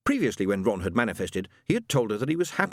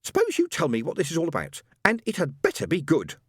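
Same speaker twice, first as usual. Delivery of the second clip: cold rage; 7 semitones higher than usual. A narrator's reading. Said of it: "cold with rage".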